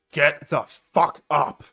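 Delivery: loud, slow shaking yell; furious shout. Acted. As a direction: roar it, dragging each word out. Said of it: "He's like".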